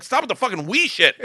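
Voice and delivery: high, loud retort; exasperated protest. Retold or imitated. Student to gangster cursing at cop, loud exasperated protest. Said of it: "I'm like".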